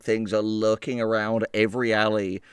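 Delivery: normal talking voice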